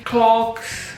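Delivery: ordinary talk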